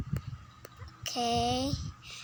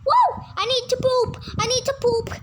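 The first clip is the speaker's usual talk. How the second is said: excited; 7 semitones higher, 4 dB louder than usual. Acted. Rushing to bathroom excited.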